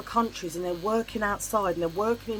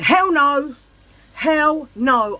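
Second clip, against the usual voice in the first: higher, louder horrified refusal; retold outburst; 7 semitones higher, 8 dB louder, much slower than usual. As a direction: recoil loudly at the sight. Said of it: "I lost my shit".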